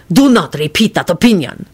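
voiced warning in an accented character voice; threatening hiss. A narrator's reading. Radu warns Lupin over his opinion, threatening. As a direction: warn him off sternly, every word separate. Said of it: "Radu hissed threateningly".

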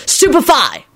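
high, forceful incantation; spell cry. Acted. Lupin casting at three robed attackers, calling out.

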